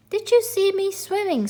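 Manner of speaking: put-on a small child bursting with pride; high excited squeak. A narrator's reading. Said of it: "squeaked Roo excitedly".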